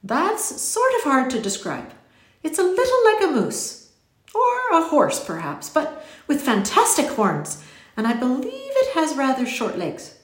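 high, lilting eccentric description; animated storybook gentleman describing a marvel; musing stranger. A narrator's reading. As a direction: muse aloud with swooping emphasis.

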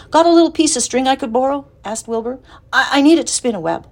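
put-on young pig asking. A narrator's reading. Pig asks the rat for string, eager and high. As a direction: ask the rat nicely, then hurry on.

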